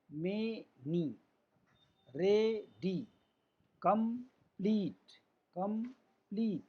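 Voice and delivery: slow words split into two beats; teacher's drawn-out syllable demo. Shown as a demonstration. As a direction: pause between the two syllables of each word. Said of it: "थोड़ा सा रुक के आराम से पढ़ेंगे".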